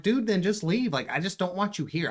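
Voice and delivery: scared homeowner urging the intruder away; mock pleading homeowner. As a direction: urge him out, lightly acted. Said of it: "I'm like".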